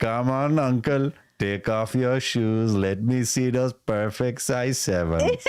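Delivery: slow, coaxing mock Indian voice; put-on Indian accent, wheedling creep; drawn-out hammed-up Indian coaxing. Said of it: "the Indian guy".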